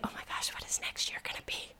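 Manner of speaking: fast, hushed, secret excitement; excited whisper. Retold or imitated. Host voicing her inner thrill about next year, whispered. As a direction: whisper it like a child awake before dawn.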